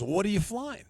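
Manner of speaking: salesman voice. Retold or imitated. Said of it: "and the guy goes".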